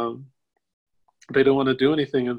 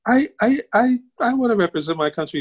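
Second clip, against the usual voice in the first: high, loud stammering rush to answer; flustered eagerness; 10 semitones higher, 5 dB louder than usual. Retold or imitated. Storyteller pleading with the caller to be picked, flustered and eager. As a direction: blurt it out eagerly, tripping on the first word.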